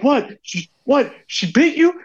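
high, alarmed questioning; startled confusion. Retold or imitated. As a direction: sound like you just heard a shriek and can't see why. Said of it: "And I'm like".

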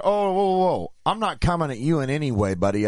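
indignant protest, starting high and easing down. Retold or imitated. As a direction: push back in a heated argument. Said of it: "that's when voices were raised".

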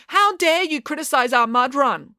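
high-pitched outraged challenge; affronted high-voiced protest; indignant heckling. A narrator's reading.

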